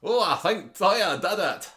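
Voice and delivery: hammed-up Scottish accent doing Adam. The host impersonating Adam in a hammed-up Scottish accent. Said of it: "I can obviously do Adam".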